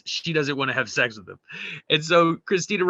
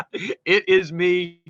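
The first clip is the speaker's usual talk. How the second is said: ordinary delivery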